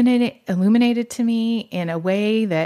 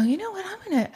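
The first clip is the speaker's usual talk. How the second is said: sudden excited realization; 12 semitones higher, 4 dB quieter, much faster than usual. Retold or imitated. Retelling the moment she decided to pray, high and excited. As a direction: pitch jumps high as the idea hits. Sudden idea retold, voiced.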